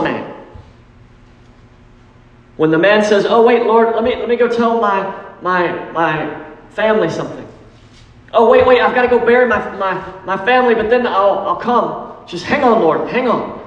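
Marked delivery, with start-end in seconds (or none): 3.3-13.6 pleading excuse-maker. Acted. Man stalling Jesus's call.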